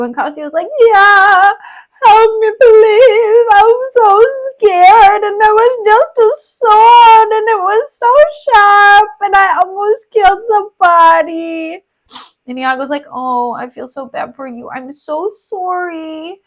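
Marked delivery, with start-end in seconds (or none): 0.8-11.8 high, slow whimpering plea; hammed-up frightened crying; mock scared sobbing. Retold Cassio begging Iago for help, mock crying. 13.1-16.4 soft, slow comforting; mock sympathy. Iago pretending to comfort Cassio, softly.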